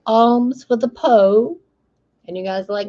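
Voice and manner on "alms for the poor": slow, high, loud pleading; beggar. Retold or imitated. Poor person begging rich folks, slow high loud plea. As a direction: drag out a pitiful beg. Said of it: "someone else is like".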